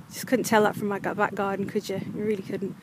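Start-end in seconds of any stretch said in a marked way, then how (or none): none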